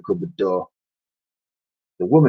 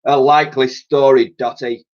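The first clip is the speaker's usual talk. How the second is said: scoffing; 5 semitones higher, 5 dB louder than usual. Acted. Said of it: "the man scoffed".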